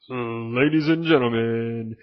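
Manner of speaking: booming, very slow ring-announcer build; hype man intro. Retold or imitated. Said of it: "he had a nasty hype man intro".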